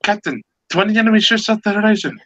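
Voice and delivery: very high, rushed alarm; acted panicky report to the captain; high and fast, urgent warning; alarmed lookout. Joke lookout sees twenty ships.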